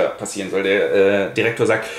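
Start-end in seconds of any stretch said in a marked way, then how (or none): none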